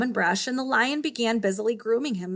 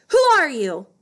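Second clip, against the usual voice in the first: a loud, demanding lion yell; 4 semitones higher, 11 dB louder than usual. Acted. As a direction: bark the question at the stranger. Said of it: "the lion yelled at my father".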